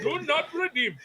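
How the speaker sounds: laughing, mock Indian accent; joking Indian accent catchphrase. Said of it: "an Indian accent".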